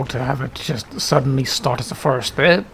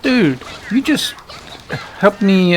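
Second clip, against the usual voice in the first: surprised; 7 semitones higher, 3 dB louder, slower than usual. Real, not acted. Friend unexpectedly helps in game.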